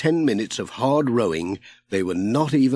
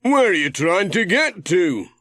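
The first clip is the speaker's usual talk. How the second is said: higher, a bit louder friendly sailor; Ted, voiced higher; 8 semitones higher, 4 dB louder than usual. A narrator's reading. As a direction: call to Pat from the boat, curious. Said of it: "he said".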